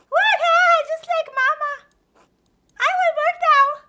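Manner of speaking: put-on high, loud child voice; squeaky kid greeting. Child voice in game.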